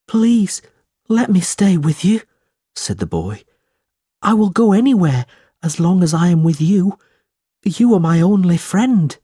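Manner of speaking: put-on a desperate runaway boy clinging to his only friend; runaway boy begging to stay, high and pleading; pleading boy Smike. Narrator voices Smike pleading.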